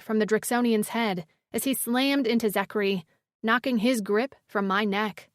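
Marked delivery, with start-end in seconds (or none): none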